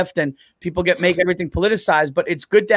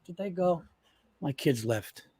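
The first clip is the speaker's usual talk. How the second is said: hushed confession; 7 semitones lower, 9 dB quieter than usual. Real, not acted. Checking kids left before confessing.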